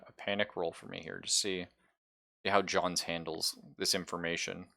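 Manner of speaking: usual speaking voice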